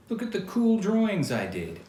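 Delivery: high, loud eager boast; excited teen. Acted. Keith answering his mother, loud excited boast. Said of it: "he answered".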